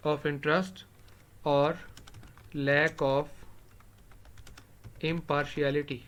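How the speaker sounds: one word at a time, long pauses between; very drawn-out, halting classroom dictation; dictating while writing. Real, not acted. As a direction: speak each word as you write it out. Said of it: "اس کو میں بلکہ لکھ لیتا ہوں".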